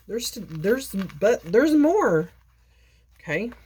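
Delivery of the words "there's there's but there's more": teasing reveal. Real, not acted. Teasing the next item.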